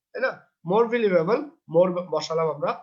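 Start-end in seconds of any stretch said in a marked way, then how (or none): none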